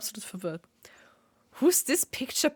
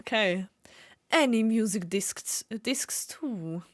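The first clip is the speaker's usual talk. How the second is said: normal talking voice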